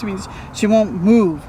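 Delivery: ordinary delivery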